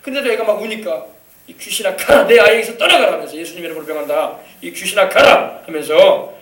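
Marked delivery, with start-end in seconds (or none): 1.5-3.1 loud, forceful command with raised pitch; stern rebuke. Retold or imitated. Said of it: "막 꾸짖고". 3.4-5.6 stern rebuke. Retold or imitated. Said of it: "막 꾸짖고".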